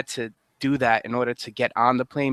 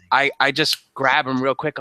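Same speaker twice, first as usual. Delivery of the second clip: ordinary talk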